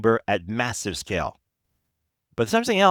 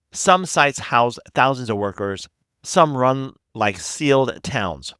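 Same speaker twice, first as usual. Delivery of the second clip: usual speaking voice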